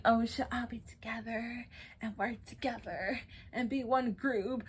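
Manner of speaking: a soft, airy sing-song that mimics her fake unity; mocking Aubrey. Mimicking Aubrey's plea for unity, soft and mocking. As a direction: make her call for unity sound sappy and fake. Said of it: "And now she's saying".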